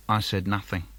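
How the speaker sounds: soft, low sulky murmur; sulky quiet. Acted. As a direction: say it under your breath. Sulky silence line.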